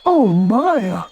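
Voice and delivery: high cheerful cartoon bear; Pooh voice. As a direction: play Pooh, delighted and hopeful.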